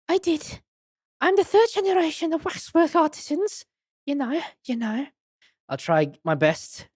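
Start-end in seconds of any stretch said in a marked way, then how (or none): 0.1-5.1 put-on high French-accented madame; Esmeralda Tuspels. Madame tells visitors of her waxwork lineage, high French accent. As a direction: attempt a French accent in a raised voice. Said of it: "they don't write the accents down".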